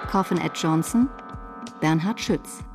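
usual speaking voice

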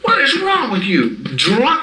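high-pitched acted telling-off; in-your-face scolding. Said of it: "get right in his face and go".

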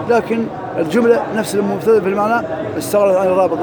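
ordinary delivery

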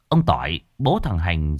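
plain reading voice

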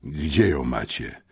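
slow, stunned asking; choked-out question. A narrator's reading. Stunned Józek asks about the sabre, choking the words out. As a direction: force the question out slowly in amazement. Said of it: "wykrztusił wreszcie".

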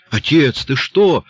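high, loud reproach to a father; startled scolding question; sharp rebuke. A narrator's reading. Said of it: "одёрнула его Эльзи".

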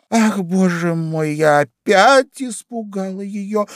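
high, slow lament; plaintive exclamation. A narrator's reading. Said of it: "жалобно воскликнула Алиса". (жалобный протяжный возглас)

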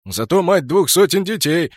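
loud, high teasing bark. Acted. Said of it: "рявкнул Кракен".

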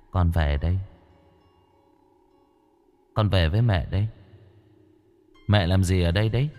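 put-on low, soft ghost-child voice; ghostly son. A narrator's reading. Dead son greeting his mother, low and hushed. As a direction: speak low and hushed like a returning spirit.

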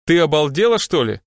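loud, indignant outburst; flaring up. A narrator's reading. Boss turns on his assistant, flaring up. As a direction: explode on the first words. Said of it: "взвился Страйк".

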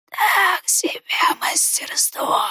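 high slow whisper, mildly annoyed. A narrator's reading. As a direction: toss it off in mild annoyance. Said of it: "с лёгкой досадой бросил чужак".

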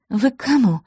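rising, startled ask at the door; surprised question. A narrator's reading. Maya opening the door to strangers, surprised. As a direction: caught off guard by strangers. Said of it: "удивилась хозяйка".

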